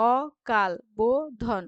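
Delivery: অকাল বোধন slow, loud, in separate beats; split into syllables. Shown as a demonstration. Teacher dividing the phrase, slow spaced syllables. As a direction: say অ, কাল, বো, ধন one by one. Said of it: "শেষ দলগুলির মধ্যে".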